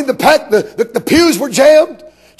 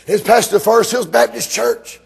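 usual speaking voice

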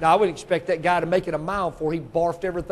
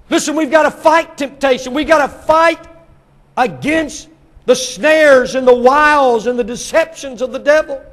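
loud, raised-pitch exhortation; fervent preaching; 6 semitones higher, 8 dB louder than usual. Real, not acted. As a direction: drive it home to the congregation. Preacher exhorting at full force.

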